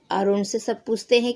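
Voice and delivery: normal talking voice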